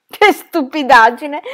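loud, scornful and slow; scornful outburst. Acted. Aunt scoffs at Gertrude's plan, loud and scornful. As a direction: scoff at her niece's foolish idea. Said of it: "disse la signora Pratt".

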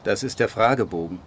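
langsam und getragen vorgelesen; Drehbuch vorgelesen. Acted. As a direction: lies die Szenenanweisung gedehnt vor. Liest Drehbuch-Szene vor. (Autor liest seinem Publikum das Drehbuch langsam vor)

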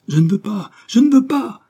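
put-on desperate child's shout, high and loud; crying out. A narrator's reading. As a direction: scream it while struggling free. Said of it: "cria l'enfant en se débattant". (cri désespéré d'enfant)

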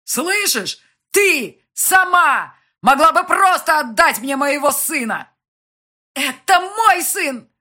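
very high, very loud, drawn-out yells; furious shouting. A narrator's reading. Parents fighting over their son, furious shouting. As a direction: yell it with full force.

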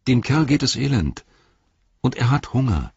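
Frodo's voice. Acted.

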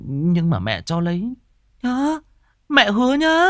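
high, singsong begging for a promise; childish wheedling. Acted. Son coaxing his mother to promise, childish and high. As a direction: make her promise like a child.

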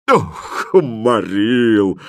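long, drawn-out worn-out groan. A narrator's reading. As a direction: groan it out slowly, exhausted from dancing. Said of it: "простонал повар". (со стоном, изнемогая)

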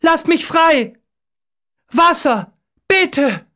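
high, desperate captive's shout; urgent high cries; calling out loudly. A narrator's reading. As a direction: shout for release, pleading. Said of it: "ruft er laut".

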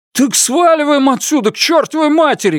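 high furious yell; shouting. A narrator's reading. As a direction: yell it, wounded and frantic. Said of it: "кричит он". (крик)

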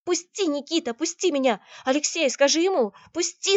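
high, loud desperate cries; screaming protest. A narrator's reading. Girl struggles to get free of Никита, high shouted pleading. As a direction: cry out to be let go. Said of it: "закричала девушка".

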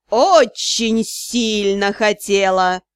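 slow low hiss. Acted. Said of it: "Срывается на шипение свекромонстр".

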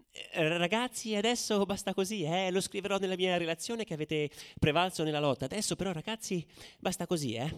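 put-on gently shooing the boys like a naive official; mock policewoman. Left-wing policewoman calming muddy rioters, lightly mocking placid voice. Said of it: "comincia a fare".

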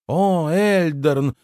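slow, high mocking drawl of his words; mock imitation. A narrator's reading. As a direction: parrot him back, teasing. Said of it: "передразнила девушка Рувина". (медленно и насмешливо передразнивает)

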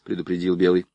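ordinary delivery

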